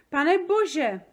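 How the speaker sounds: high, loud gasp of alarm; startled cry. A narrator's reading. Sofie sees the nightmare in the jar, loud high cry. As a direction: cry out in shock. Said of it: "vykřikla".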